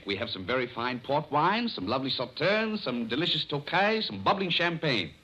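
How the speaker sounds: normal talking voice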